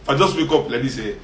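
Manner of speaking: ordinary delivery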